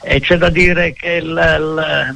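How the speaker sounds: ordinary talk, nothing marked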